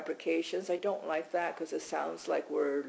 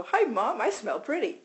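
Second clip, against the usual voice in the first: higher and louder, a cheerful voiced dog; happy dog voice; 4 semitones higher, 7 dB louder than usual. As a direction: play the happy dog greeting Mom, a bit bigger. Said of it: "They kinda come in and go".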